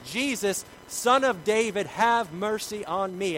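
high, loud, stretched-out cry; top-of-lungs shout. Acted. Urging listeners to cry to Jesus, shouting. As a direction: shout it with everything you have. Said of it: "shout at the top of your lungs".

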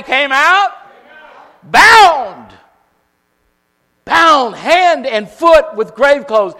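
high, loud, slow preaching climb; building revival preaching; preaching at full force. Real, not acted. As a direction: drive it home to the congregation.

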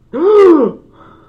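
loud high theatrical gasp; mock gasp. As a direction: gasp in fake outrage. Mock shock at disobedience.

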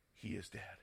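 very soft and low-pitched; acted murmur of onlookers; hushed pronouncement. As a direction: let it fall to a grave hush. Onlookers pronounce the boy dead.

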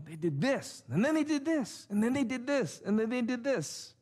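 mock stern lecture; lecturing tone. Shown as a demonstration. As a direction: lecture them item by item. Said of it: "that lecturing tone".

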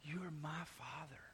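whispered softly, close and tender; intimate whisper. Shown as a demonstration. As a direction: breathe it like a secret to your father. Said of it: "it is a sense of".

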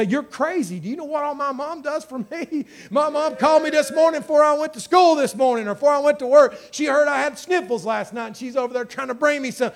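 high-pitched, insistent protest; indignant child. Acted. Child at school defending mom's love, indignant.

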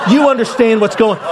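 high, heated challenge; retold threat. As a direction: push the warning to scare him. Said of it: "I'm trying to scare this guy".

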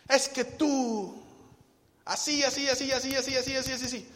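high, mock scolding rant; nagging wife. Wife venting everything at her husband in a high scold. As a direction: rattle off the complaints. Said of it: "le dijo a su marido".

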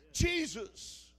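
high, loud desperate cry; full-voiced high shout; screaming. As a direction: scream the name after him. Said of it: "screaming out".

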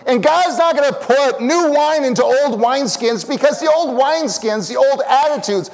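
high, loud, impassioned preacher shout; fired-up sermon peak; full-force preaching. Real, not acted. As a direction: proclaim it loud and high to rouse the church.

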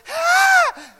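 very high, loud excited shriek; imitating a wild race-fan yell; thrilled yell. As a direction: scream as the cars fly by. Cars roar past, he yells.

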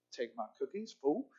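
mock grumble under the breath; muttered retort. Woman eyeing the cookie taker, muttered retort.

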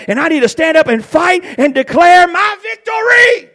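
high, loud pulpit tirade; rallying revival preacher at full volume; full-force preaching. Acted. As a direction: build to a shouted, triumphant climax.

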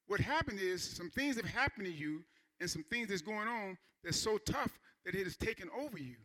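ordinary delivery

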